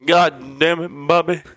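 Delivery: high-pitched mock cartoon-dad cursing; Hank Hill. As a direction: curse at your son in a put-on cartoon voice. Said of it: "King of the Hill".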